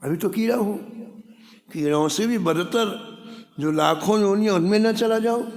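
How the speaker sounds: high, rising plea of dread; worried worm. Retold or imitated. Worm dreads sinking to worse births.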